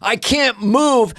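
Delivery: mock angry fan. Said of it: "Shut the fuck up".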